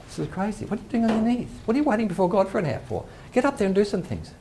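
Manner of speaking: high, fast, exasperated nagging; mock impatient inner voice. Preacher mimicking his restless self scolding prayer, high and impatient. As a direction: nag yourself to get up off your knees.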